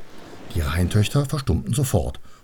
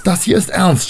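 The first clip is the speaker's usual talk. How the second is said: high, raised, exasperated cry; angry yell; 10 semitones higher, 5 dB louder than usual. A narrator's reading. Annabel yells at the Rhine daughters, exasperated. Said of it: "schrie Annabel".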